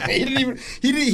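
normal talking voice

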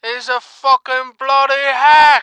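loud, high furious yell; angry shout. Acted. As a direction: bark it out in outrage. Caller character rants about Zo.

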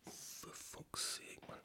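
breathless whispered swearing, almost no voice; whispered curse. Real, not acted. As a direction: hiss it under your breath in frustration. Muttered frustration under breath.

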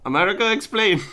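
mock demand an answer; quoting a meme. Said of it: "I quote this all the time".

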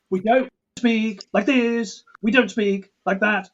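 high, slow and evenly stressed, "We don't speak like this" in heavy beats; slow, high, beat-heavy mock Eastern European rhythm; Eastern European speech rhythm. Shown as a demonstration. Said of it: "the sort of rhythm you might get in a ... Eastern European language".

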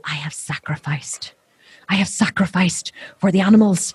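whispered, solemn martyr tone; self-righteous whisper. Retold or imitated. Mimicking vegans proud of their sacrifice, whispered. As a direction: whisper it like a sacred vow. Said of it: "they go".

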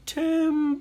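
high, long-drawn warning holler; lumberjack call. Acted. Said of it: "it sounded like".

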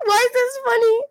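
nervous laughter while confessing; laughing through something awful; horrified amusement. Real, not acted. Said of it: "why are you guys laughing".

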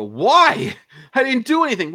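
very high, loud wail; retold incredulous yelling; mock outraged protest. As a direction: cry out your innocence, hammed-up. Protests being booked, acted.

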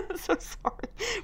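high, slow laughing sorry; laughing apology. Real, not acted. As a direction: giggle through the apology. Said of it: "Sorry, I'm yawning a lot".

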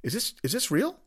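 high, stunned "are you kidding me"; incredulous disbelief. Retold or imitated. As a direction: ask it like you can't believe what you read. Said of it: "I go".

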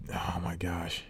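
slow breathy whisper of annoyance; exasperated whisper. Real, not acted. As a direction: hiss it out under your breath, fed up. Exasperated at loud moviegoers.

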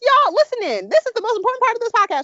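high, fast, loud appeal; excited call to listeners; urgent announcement. Real, not acted. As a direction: grab the listeners' attention.

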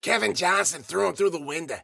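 put-on high-pitched croaky old woman; frog-in-a-stovepipe voice. A narrator's reading. Ruby tells who threw him through the window, croaky voice. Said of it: "Ruby had a voice like a frog in a stovepipe".

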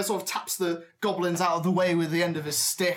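ordinary delivery

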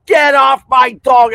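loud, high mock shout of an angry owner; retold yell. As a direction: bark the order at a stranger.

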